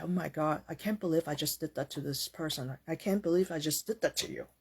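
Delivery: soft, low murmur of regret; hushed, acted disbelief at oneself; remorseful self-talk. Cooled down, regretful self-reflection acted out.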